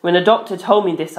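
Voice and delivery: ordinary delivery, nothing marked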